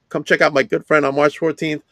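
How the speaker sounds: ordinary delivery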